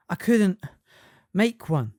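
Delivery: out of breath, loud gasps; panting. A narrator's reading. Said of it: "he panted".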